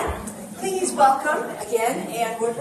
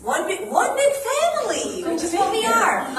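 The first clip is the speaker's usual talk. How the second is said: laughing; 5 semitones higher, 4 dB louder than usual. Real, not acted. Laughs while naming the song.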